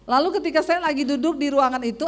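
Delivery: normal talking voice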